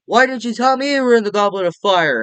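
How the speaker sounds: high, loud whiny accusation; mock crybaby. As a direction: imitate a jealous crybaby complaining. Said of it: "he's a fucking crybaby in this".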